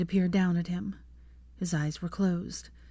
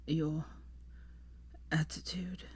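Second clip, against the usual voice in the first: soft words with long gaps; dying man struggling on; weak, failing voice; 11 dB quieter, slower than usual. A narrator's reading. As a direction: fade away on each word. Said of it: "he went on".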